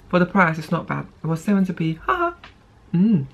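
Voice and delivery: talking with mouth partly full; muffled mouth-closed hum; chewing food. Real, not acted. Seen 1: Lips closed, chewing at start; fork of pasta held near mouth.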